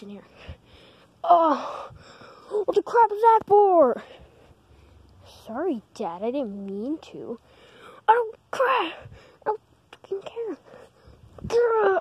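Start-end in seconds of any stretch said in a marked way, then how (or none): 1.2-4.0 angry dad. Acted. Kid voicing his angry dad. 8.1-12.0 raging dad. Acted. Voiced dad raging at the kid.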